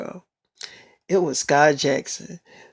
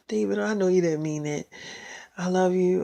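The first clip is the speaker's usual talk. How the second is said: softer, gentle and loving; soft tender forgiveness; 8 dB quieter than usual. Retold or imitated. Girlfriend quietly tells boyfriend she loves him.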